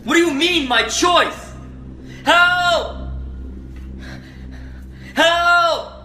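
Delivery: frantic high yelling for help; panicked shouting. Real, not acted.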